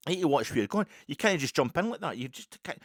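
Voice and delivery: retold scolding. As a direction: act out an indignant stranger's reprimand.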